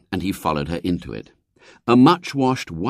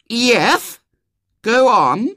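put-on high, bossy little girl; a spoilt small girl giving orders; 13 semitones higher, 4 dB louder, much slower than usual. A narrator's reading. Violet Elizabeth voiced.

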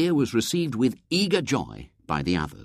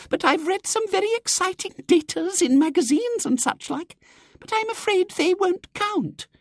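put-on much higher, fluttery little old lady; Miss Simpke; 4 dB louder than usual. Miss Simpke apologising to the society, fluttery old lady. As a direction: twitter apologetically.

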